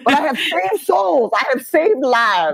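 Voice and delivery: high squeal through laughter; helpless laughing self-defense; laughing protest. Real, not acted. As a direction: protest loftily while cracking up. Laughing, mock-protesting her book's worth.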